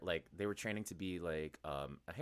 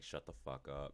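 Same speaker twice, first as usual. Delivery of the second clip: retold disbelief; 8 semitones lower, 10 dB quieter than usual. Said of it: "He's like".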